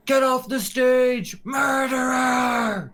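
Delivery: high, loud screamed jeer; angry protester's shriek; screaming heckler. Acted. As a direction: scream it from the crowd, stretching the last word.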